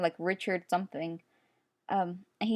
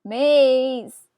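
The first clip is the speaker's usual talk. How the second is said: loud call; 4 semitones higher, 9 dB louder than usual. Real, not acted. Calling out character name.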